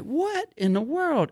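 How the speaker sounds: high, slow, stunned; a slow, high disbelieving gasp of words; shocked disbelief. Retold or imitated. Pushed by the security guard, slow high shocked disbelief.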